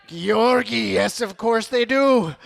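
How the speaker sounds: usual speaking voice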